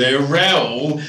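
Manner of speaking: low, drawn-back understated request; customer to bloke. Retold or imitated. Customer asking bloke Raoul for tap water, low and hesitant. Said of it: "he said to this bloke".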